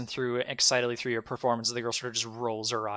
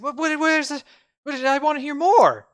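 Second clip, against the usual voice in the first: much higher, louder, eager dazed voice; a spellbound fool begging for more music; dazed charmed guy; 9 dB louder than usual. Acted. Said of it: "He's like".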